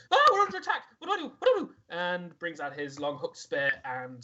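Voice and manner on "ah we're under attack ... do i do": high, loud panicky squeal; a frightened little creature crying out in alarm; panicked Mapido. Acted. Game master voicing Mapido as the fight breaks out, high panicked yelp. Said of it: "Mapido says".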